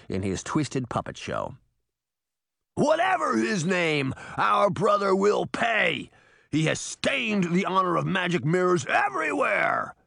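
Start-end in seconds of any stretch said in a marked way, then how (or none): 2.8-9.9 roar. Acted. Titan roaring about his brother's dishonor. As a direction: bellow with wounded pride. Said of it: "Titan roared".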